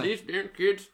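put-on high grumpy old man voice; squeaky old man griping at kids. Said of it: "That was a Josh Bricky impression".

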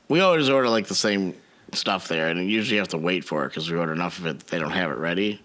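ordinary delivery

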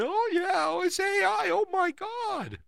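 very high, slow falsetto gush; mock excited gushing. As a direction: gush like a starstruck fan, high and drawn out. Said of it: "here we are all excited".

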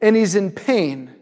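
slow, deliberate words, each phrase spaced out; slow earnest reasoning. Retold or imitated. Preacher voicing his own angry thought while watching, slow and deliberate. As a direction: lay out the reasons slowly, weighing each word. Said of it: "And I'm like".

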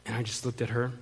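ordinary delivery

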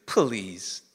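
low, soft, drawn-out puh-lease; two-syllable please. Acted. Gideon doubting the angel's word, low drawn-out two-syllable please. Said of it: "I think this is how he sounds".

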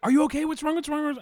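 high-pitched imitation of alarmed wife; worried wife. Wife on the phone hearing him yell, imitated worry. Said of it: "And my wife's like".

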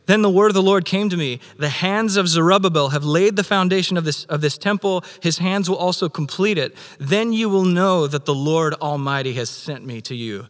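loud, high proclamation of the verse; forceful scripture reading. Acted. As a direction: preach the verse to the mountain.

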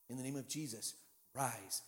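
softly spoken "rise"; quiet command. Retold or imitated. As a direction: say it barely aloud, almost to yourself. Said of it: "about this loud".

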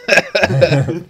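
high, loud, hammed-up character voice; character impression. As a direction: belt out the name in character. Joining in the film character impression.

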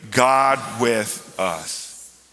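very slow, spaced words; slow unison lead. Real, not acted. Preacher leading the congregation to say it with him, slowly. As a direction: lead it word by word, drawn out.

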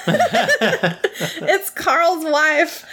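high, very slow quote broken by giggles; giggling too hard to get the words out; laughing through a quote. Retold or imitated. To the viewer about Buttons and the widow seagull, laughing.

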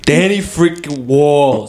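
put-on slow drawn-out New York accent. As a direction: stretch the name out New York style. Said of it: "You gotta stay with a New York accent".

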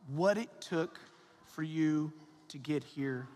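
gentle and slow. Acted. Slow reflective sermon lines.

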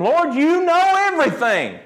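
exasperated high-pitched protest, imitating Peter; frustrated. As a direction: protest in acted frustration, pitch jumping well up. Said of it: "like, frustrated".

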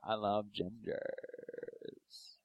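low, soft, very drawn-out flirting; mock seductive admirer. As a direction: stretch it into a soft, low come-on. Voicing an online dating admirer.

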